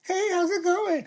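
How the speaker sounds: very high falsetto girly imitation; little sweet voice. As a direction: put on a sugary, high-pitched feminine voice. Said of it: "the little sweet voice".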